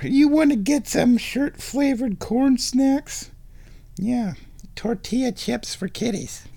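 high, drawn-out pet voice. Real, not acted. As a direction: tempt a cat in a high coo. Offering the cat snacks.

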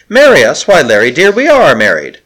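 high and loud in disbelief; astonished. Acted. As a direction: exclaim it, amazed. Handmaiden astonished at question.

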